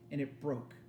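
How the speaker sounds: ordinary delivery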